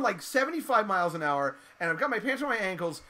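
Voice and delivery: ordinary delivery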